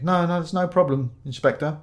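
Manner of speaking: deflating. Acted. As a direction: climb down before the inspector.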